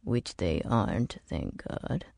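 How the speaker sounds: very low, soft mutter. A narrator's reading. Bernard mutters an aside about the dead, low and hushed. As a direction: drop the voice right down, trailing almost to nothing. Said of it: "He lowered his voice and muttered".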